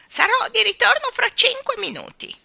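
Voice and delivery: very high, loud parting cry; shouted call. A narrator's reading. Starling flying off calls back, high shout. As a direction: call it back loudly, pitched right up high. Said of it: "gridò voltando la testa".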